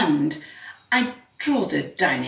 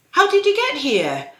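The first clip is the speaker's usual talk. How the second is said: high, louder, incredulous question; amazed question; 13 semitones higher, 5 dB louder than usual. Acted. Narrator asking the living snowman how he came, amazed. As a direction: ask it high and loud in wonder.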